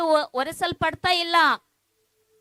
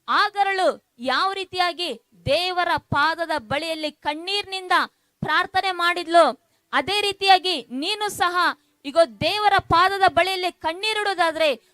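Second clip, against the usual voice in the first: insistent preacher's promise, slightly louder; fervent preaching; 3 semitones higher, 3 dB louder than usual. Real, not acted. Pastor promising tearful listeners God will act, fervent preaching. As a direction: press the promise home to the crowd.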